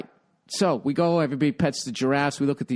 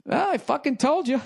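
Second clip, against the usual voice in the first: tour guide quote; 9 semitones higher than usual. Retold or imitated. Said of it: "he just looks at her and he goes".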